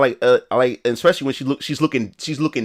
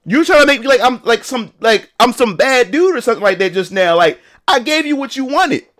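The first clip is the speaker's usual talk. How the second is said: mad; 7 semitones higher than usual. Retold or imitated. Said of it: "I was more mad than anything".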